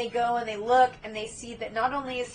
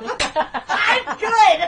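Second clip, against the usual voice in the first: usual speaking voice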